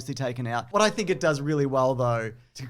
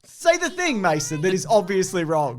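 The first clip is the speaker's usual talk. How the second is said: high, loud, playful insistence; loud teasing demand; 4 semitones higher, 3 dB louder than usual. Real, not acted. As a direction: goad him loudly into saying it. Teasing friend, demanding loudly.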